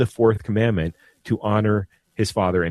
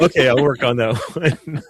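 laughing; 3 semitones higher than usual. Real, not acted. Laughing off teasing about accent.

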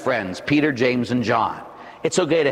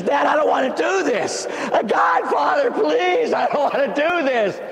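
Jesus begging his Father in the garden, shouting; desperate begging shout; 11 semitones higher than usual. Acted. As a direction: beg your father not to make you do this. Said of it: "He begs his father".

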